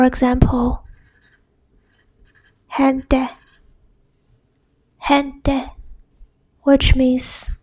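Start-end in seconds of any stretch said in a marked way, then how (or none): 2.8-5.7 "gente" starting on breathy H, HEN-teh. Shown as a demonstration. Teacher giving example word for learners, breathy H. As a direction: open "gente" with an H sound, not a G. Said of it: "then it's pronounced".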